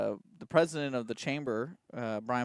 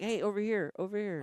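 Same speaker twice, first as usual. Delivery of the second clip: higher-pitched imitation of flagging someone; calling out; 6 semitones higher than usual. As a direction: mimic getting someone's attention. Imitating man flagging someone across room.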